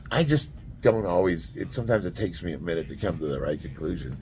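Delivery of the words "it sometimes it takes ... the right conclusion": ordinary delivery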